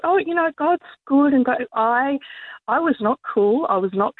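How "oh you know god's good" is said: high, breezy reassurance; carefree. Retold or imitated. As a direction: say it like nothing could go wrong. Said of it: "And I always thought".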